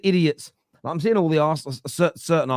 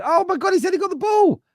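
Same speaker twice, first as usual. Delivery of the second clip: outraged; 5 dB louder than usual. Real, not acted. Outraged at foul call.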